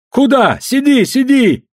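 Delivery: high, loud protest; players' shouting. A narrator's reading. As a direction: cry out to stop someone leaving. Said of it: "закричали игравшие".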